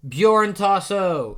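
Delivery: high, loud, drawn-out shout; calling out. A narrator's reading. Little brother running up to grab him, drawn-out shout. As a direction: stretch the name into a loud call.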